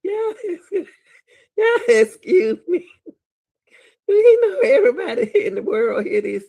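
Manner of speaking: loud laughter through the words; cracking up while talking; laughing. Real, not acted. Laughing hard at the man in the video.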